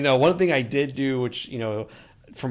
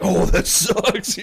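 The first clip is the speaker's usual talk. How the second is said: higher, louder, slow hammed-up letdown; mock disappointment; 7 semitones higher, 7 dB louder, much slower than usual. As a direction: stretch out an exaggerated groan of regret. Teammate hit by lightsaber.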